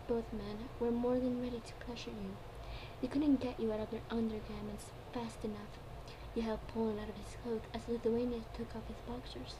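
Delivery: plain reading voice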